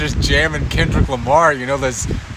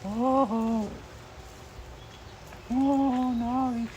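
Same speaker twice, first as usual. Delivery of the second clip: soft pet coo; 7 semitones higher, 11 dB quieter, much slower than usual. Real, not acted. Cooing at dog.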